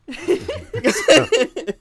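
loud high snorting laugh. Real, not acted. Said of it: "There's the, there's the snort".

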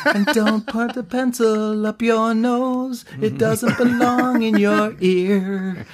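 high, slow, dreamy filmstrip narrator; sing-song schoolroom safety lecture; lectury hippie lady voice. Retold or imitated. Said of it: "my favorite lectury hippie lady voice".

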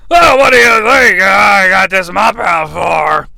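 loud slurred retort; drunk wrestler. Acted. Wrestler answering a haircut question, loud drunken slur. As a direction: fire back with a boozy holler. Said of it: "He's under the influence".

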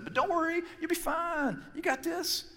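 high, light, breezy reassurance; easygoing Jesus voice; casual reassuring Jesus. Retold or imitated. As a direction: toss it off like it's no big deal. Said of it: "Kinda, that's how he said it".

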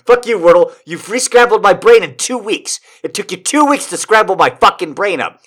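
high, heated outburst; mock-angry rant. Real, not acted. Reader blaming Wordle for the misread, high indignant rant. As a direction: curse out the game in exasperation.

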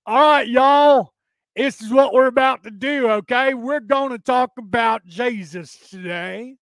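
hammed-up evangelical sermon; mock evangelical preacher. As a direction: belt it out like a revival preacher, stretching the words. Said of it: "there goes one of those evangelicals".

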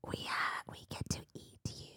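soft hissing monster whisper; whispered snake voice. Acted. Host voicing the Naga taunting Feyre, soft snake whisper. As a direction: whisper it like a creeping serpent.

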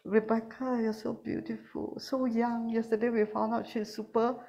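hushed, giggly flattery; quiet praise. Shown as a demonstration. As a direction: praise her almost under your breath.

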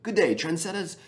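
high, put-on New Zealand accent greeting; chirpy Kiwi podcast-host hello, pitched well up; New Zealand coach impression. Podcaster imitates the Kiwi coach's opening line, in a high New Zealand accent. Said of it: "his accent and the way he talks".

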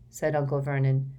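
plain reading voice

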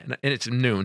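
ordinary talk

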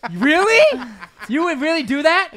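high, loud laughing shock; laughing disbelief. Real, not acted. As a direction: gasp out the question through laughter. Friend shocked by cold refusal.

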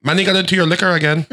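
high mock-wife voice; sitcom wife impression. Wife telling grandpa about Manny, high sitcom wife impression. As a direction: report to your husband in a high put-on voice.

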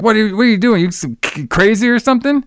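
mock scolding mom. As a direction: lightly play the outraged store manager.

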